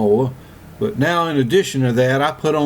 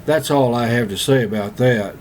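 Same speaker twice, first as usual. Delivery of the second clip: usual speaking voice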